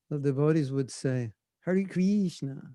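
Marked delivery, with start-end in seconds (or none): 1.6-2.6 drawn-out American "Kreeshna"; New York American accent. Retold or imitated. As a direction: imitate early New York devotees, stretched out. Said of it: "very New York, very American".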